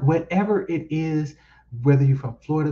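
ordinary talk

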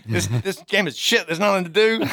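loud, high exasperated complaint; annoyed rant. Retold or imitated. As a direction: whine it out like a let-down gamer. Friend complaining about the game.

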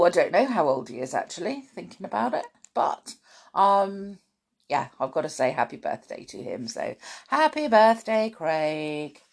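ordinary delivery

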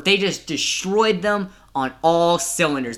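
normal talking voice

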